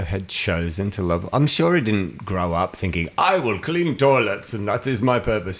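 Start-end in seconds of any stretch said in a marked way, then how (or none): 3.2-5.6 higher, mock-grand proclamation; playfully solemn vow; mock declaration. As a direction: proclaim it like a noble calling. Mocks imagined childhood ambition.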